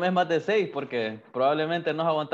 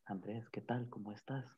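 very soft, lower-pitched controlled voice; volume turned down; 4 semitones lower, 17 dB quieter than usual. Shown as a demonstration. Co-host showing a regulated voice to Andrés, hushed and low. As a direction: demonstrate speaking with the volume right down. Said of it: "bájale al volumen".

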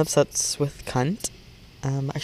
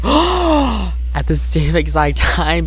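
excited; 3 semitones higher, 5 dB louder, much slower than usual. Retold or imitated. Excited retelling, gasp.

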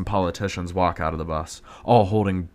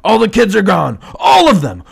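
high, loud alarmed yell; panicked shout; 13 semitones higher, 12 dB louder than usual. Acted. Henchman shouting to partner that kids vanished, panicked yell. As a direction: shout the bad news in a panic.

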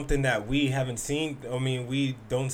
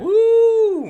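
loud, high celebratory "woo"; long, piercing cheer of approval; high whoop; 12 dB louder than usual. Real, not acted. Comic cheering the host's grandfather photo, whooping loudly.

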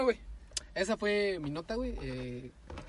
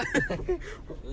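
high laugh; 13 semitones higher, 4 dB quieter than usual. Real, not acted. Laughs at own dirty joke.

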